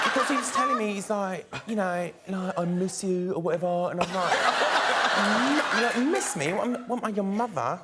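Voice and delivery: Towie-style Essex gossip; Essex accent. Shown as a demonstration. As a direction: imitate an Essex reality star telling a story. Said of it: "That's the only accent I can do".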